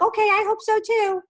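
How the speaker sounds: high, loud mocking mother voice; hammed-up sarcastic "I hope so too"; mock sarcastic mom. Mother mocking her son's hope, high and loud. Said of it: "Annette would be like".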